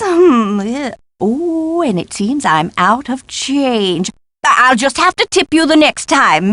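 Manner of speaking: ordinary talk, nothing marked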